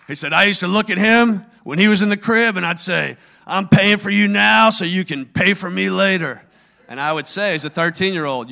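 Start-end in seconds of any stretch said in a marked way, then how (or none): 0.3-6.4 loud, booming bragging father; father bragging to friends, booming loud; booming dad voice. Retold or imitated. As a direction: boom the joke to the crowd. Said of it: "He had a booming voice".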